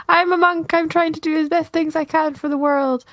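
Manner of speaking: sweet, humble little monk. Acted. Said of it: "this little monk".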